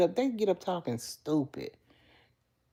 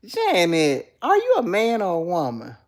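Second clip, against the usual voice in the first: mock shock; 8 dB louder than usual. Real, not acted. Loud mock-shocked question.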